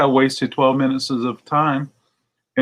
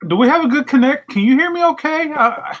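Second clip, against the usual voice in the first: much higher, louder fake confusion; mock bad line; 14 semitones higher, 4 dB louder than usual. Stalling the scammer, high loud fake connection trouble. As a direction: act as if the line is breaking up. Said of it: "And I said".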